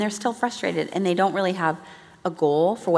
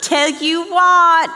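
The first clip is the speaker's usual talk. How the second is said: slow, higher, louder Australian "Tell you what"; Australian accent; 10 semitones higher, 8 dB louder, much slower than usual. Retold or imitated. Repeating the Australian wife's catchphrase, slow Australian accent. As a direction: savour the Aussie phrase in an Australian accent. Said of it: "She still had a little Australian accent".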